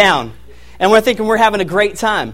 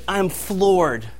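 ordinary talk, nothing marked

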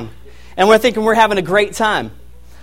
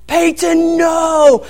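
put-on high, loud alarmed shout at the toddler; angry shout; 10 semitones higher, 5 dB louder, much slower than usual. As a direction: yell his name to stop him now. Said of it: "I'm so angry".

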